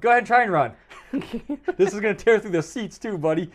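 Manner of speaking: loud, laughing taunt; joking mock gunman. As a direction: play the gunman, cracking up. Acting out threatening gunman, joking.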